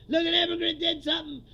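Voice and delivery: gleefully mock the breathless anchor; mock Fox anchor. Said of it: "They go".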